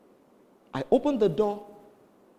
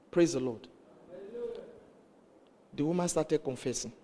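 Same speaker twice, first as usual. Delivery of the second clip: normal talking voice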